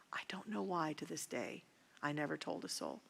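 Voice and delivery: hushed whisper, Don't tell anyone; whispered warning. Real, not acted. Father warning his child to keep silent, whispered. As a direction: breathe it as a secret threat. Confessing painful secret.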